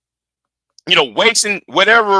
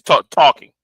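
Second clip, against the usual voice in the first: ordinary delivery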